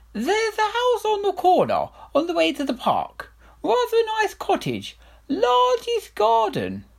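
a king avoiding eye contact; evasive king. Acted. King to Herringbone, high and evasive. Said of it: "not looking the old man in the eye".

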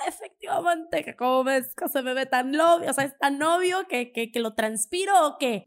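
high, loud, caught-off-guard blurt; flustered and frozen. Retold or imitated. Retelling her stunned reply to a date, high and flustered. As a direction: stammer it out like you've been found out. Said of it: "Paralizada, diciendo".

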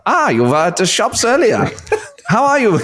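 put-on high, over-the-top cod German accent; bad German accent. Voice actor trying out a rough first take, silly German accent. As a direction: do a silly old Bond film German. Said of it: "we'll just put on a German accent".

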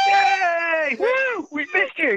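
high, loud whooping cheer. Real, not acted. Cheering Catherine back to the show, loud high whoops. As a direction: cheer her in like a crowd.

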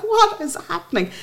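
imitating her confused reaction to the shouting; bewildered. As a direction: ask it half-laughing in disbelief. Retold confusion after laughing.

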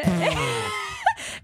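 shrieking laugh at the memory; burst of high laughter. Real, not acted. Friend recalls her drunk blunder, bursting into laughter.

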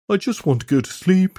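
high, whiny moan. Acted. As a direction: moan it out, worn and weeping. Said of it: "he moaned".

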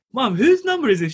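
high-pitched retold alarm at mum; exasperated son querying mum; incredulous questioning. Son asks mum about the number, incredulous.

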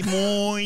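«moi» said high and loud with the Québécois oi. Shown as a demonstration. Teasing friends about accents, Québécois «moi» demo. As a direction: stretch the oi in «moi» Québécois-style.

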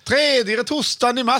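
high, slow auction call in Småland dialect; hammed-up Småland auction voice; auctioneer-like Småland accent. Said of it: "Det låter som en auktions".

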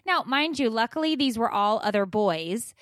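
usual speaking voice

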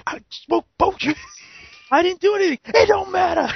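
high, loud mock gangster threat; squeaky tough-guy bravado; hammed-up tough guy. Replaying the joke threat to his cohost, high loud mock. Replaying the bit, higher and louder.

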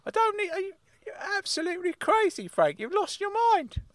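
high-pitched, worked-up uncle; Uncle Claude, worked up. Acted. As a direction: protest in a high comic character voice. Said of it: "why are you getting so worked up".

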